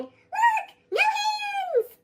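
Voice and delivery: high, loud whoop of glee; thrilled shout. Acted. Acorn shouting with delight as he falls. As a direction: yell with joy while tumbling.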